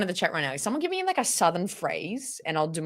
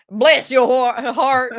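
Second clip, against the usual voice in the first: put-on Southern accent; 7 semitones higher, 9 dB louder, slower than usual. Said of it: "How do they say heart?".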